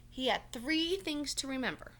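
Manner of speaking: plain reading voice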